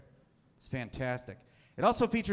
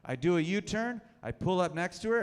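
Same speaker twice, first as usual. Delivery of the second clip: ordinary delivery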